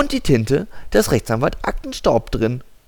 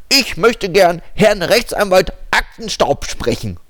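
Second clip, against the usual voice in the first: over a landline phone; 9 semitones higher, 4 dB louder than usual. Acted.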